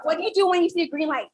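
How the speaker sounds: fast and loud, firing it off at the crowd; rushed trick question. Shown as a demonstration. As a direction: catch them off guard with a quick, loud question. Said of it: "I'll ask it again".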